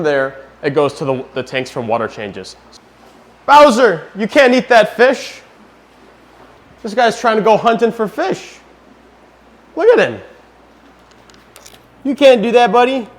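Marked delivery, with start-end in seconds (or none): 3.5-10.2 high, loud pet-scolding voice; talking to the dog. Real, not acted. Owner scolds dog eating fish. 12.0-13.1 high, loud fond telling-off of a pet; louder first warning to the dog; scolding the dog. Real, not acted. Owner scolds dog eating fish.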